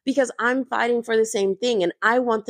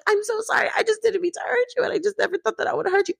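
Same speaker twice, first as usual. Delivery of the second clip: very high, fast, hammed-up weepy apology; mock crying Teresa; 12 semitones higher, faster than usual. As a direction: overplay a tearful sorry. Teresa starts to cry.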